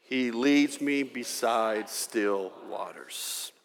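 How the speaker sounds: measured congregational reading; slow scripture recitation. Shown as a demonstration. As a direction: read it slowly like a psalm. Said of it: "here we go".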